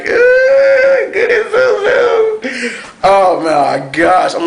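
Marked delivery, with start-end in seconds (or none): 0.0-3.0 very high, loud, stretched-out squeal; fangirl squeal. Retold or imitated. Fan cheering Zuko on his dragon, high squealing shout. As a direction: shriek it like a fan seeing her hero. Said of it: "I'm like".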